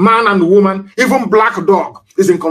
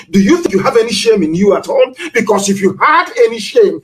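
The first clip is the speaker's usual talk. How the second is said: ordinary delivery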